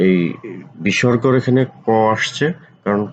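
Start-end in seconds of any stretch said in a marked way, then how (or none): none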